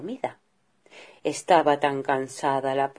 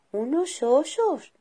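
put-on high, slow wondering little girl; girl waking to find bears; amazed small voice; astonished girl; 11 semitones higher, slower than usual. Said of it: "dijo asombrada al verlos".

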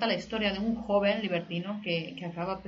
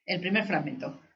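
ordinary delivery

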